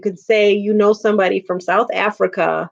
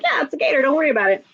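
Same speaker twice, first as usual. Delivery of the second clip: higher, breezy imitation of calm locals; mock nonchalant locals; 7 semitones higher, faster than usual. Imitating Florida locals shrugging off the gator, breezy. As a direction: toss it off like it's nothing. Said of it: "And I'm like".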